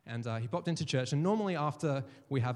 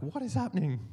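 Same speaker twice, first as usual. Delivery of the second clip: astonished; 6 semitones higher than usual. Retold or imitated. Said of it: "he's sort of freaking out".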